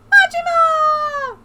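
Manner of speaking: high, loud, long-held scream of the name; drawn-out shout. Acted. Player calls out Majima's name, high drawn-out shout. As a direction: yell it out, stretching the last vowel.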